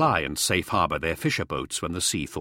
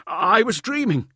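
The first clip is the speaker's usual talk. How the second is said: high, loud cry of terror; startled cry; 14 semitones higher, 5 dB louder than usual. Acted. Uplander waking in terror to Fifth standing over him, high startled cry. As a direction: wake from a fever dream crying out. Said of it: "he cried".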